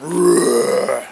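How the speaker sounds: loud startled growl thrown back; a quick loud answering growl. Retold or imitated. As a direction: growl back like someone's messing with you. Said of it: "I growled back".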